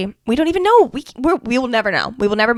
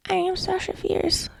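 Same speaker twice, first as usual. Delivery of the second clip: timid affirmation; 9 semitones higher, 8 dB quieter, slower than usual. Shown as a demonstration. Showing listeners the wrong, unconvinced way, soft and slow. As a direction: mumble the name with no conviction. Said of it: "you're not gonna be like".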